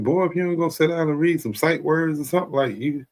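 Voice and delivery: a high-pitched scolding parent; mock parent telling a child off. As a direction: nag the kid about sight words. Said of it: "You like".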